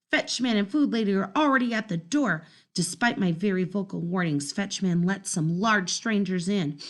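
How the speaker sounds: ordinary delivery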